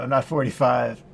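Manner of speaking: ordinary delivery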